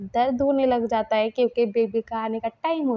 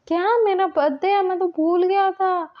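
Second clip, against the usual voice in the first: high, louder surprised gasp of a question; higher, louder, wide-eyed disbelief; 9 semitones higher, 5 dB louder than usual. Retold or imitated. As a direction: act amazed it's your birthday. Surprised at own birthday.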